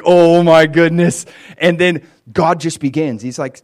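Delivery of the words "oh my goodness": a hammed-up, shaken gasp, louder and slow; mock trembling. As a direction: play Job quaking before God, half in jest. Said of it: "he's just shaking".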